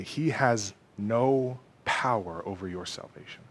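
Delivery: low, soft, fading almost to a whisper; hushed assurance. Real, not acted. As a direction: reassure them quietly, letting the last word fade. Preacher's hushed first take of assurance.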